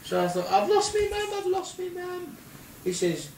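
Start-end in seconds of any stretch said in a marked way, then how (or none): 0.5-2.4 very high-pitched cry of alarm, retold; mock panic. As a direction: wail it in comic panic. Retold panic at losing mum.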